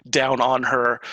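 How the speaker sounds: usual speaking voice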